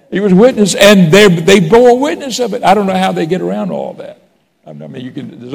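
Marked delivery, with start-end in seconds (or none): 0.1-3.9 high, loud, ringing pulpit preaching; preaching at full force. Acted. Pastor preaching the empty tomb to the congregation, full force. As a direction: lift it up and proclaim it to the church.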